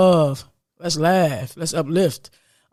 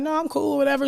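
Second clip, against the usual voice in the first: airy, higher, waving it off; dismissive brush-off; 7 semitones higher than usual. Retold or imitated. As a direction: brush it off like it's nothing. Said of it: "And I'm like".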